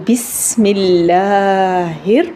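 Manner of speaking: slow recitation with a sharp whistled s in "بسم"; drawn-out "بسم اللہ" with a clear hissing seen; whistling seen. Shown as a demonstration. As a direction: hold the sibilant س of "بسم" like a whistle. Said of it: "سین کو سیٹی والا پڑھیں گے".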